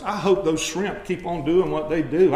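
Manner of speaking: ordinary delivery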